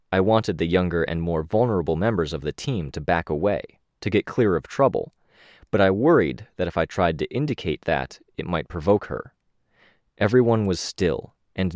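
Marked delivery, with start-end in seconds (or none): none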